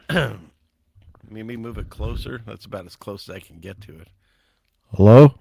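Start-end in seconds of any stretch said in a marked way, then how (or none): 5.0-5.3 loud mic check. Real, not acted. Testing the mic up close.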